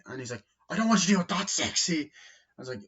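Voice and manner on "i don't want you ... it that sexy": high, loud exasperated objection; mock protest. Boss reacting to her too-sexy pitch, loud protest. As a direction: yelp it in alarm.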